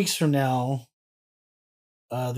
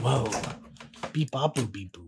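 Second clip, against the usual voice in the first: toy robot voice; 5 semitones lower, 9 dB quieter, slower than usual. Pretend. Robot noises winding down.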